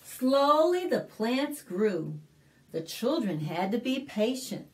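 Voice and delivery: put-on clear, lively read-aloud for little kids; story narration. Librarian reading a picture book to kids, lively storytelling.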